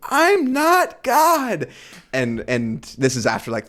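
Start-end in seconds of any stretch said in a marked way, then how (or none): 0.1-1.7 very high, very slow, stretched-out protest; imitating a preacher pleading with fans, high and very slow; earnest denial. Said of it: "and Francis Chan goes".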